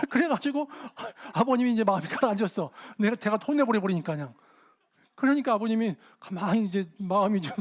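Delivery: ordinary delivery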